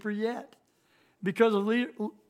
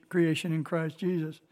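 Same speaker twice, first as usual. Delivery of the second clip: ordinary talk, nothing marked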